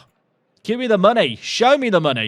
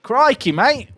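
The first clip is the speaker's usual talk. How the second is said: loud, higher mock Australian accent; 7 semitones higher, 5 dB louder, slower than usual. Streamer jokes about failing at accents, in a hammed-up Australian accent. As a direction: exclaim it in an exaggerated Australian accent. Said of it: "That was my genuine attempt".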